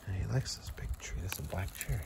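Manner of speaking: soft unvoiced whisper. Real, not acted. Owner whispers to the camera beside the cat's tree. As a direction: whisper it so as not to disturb.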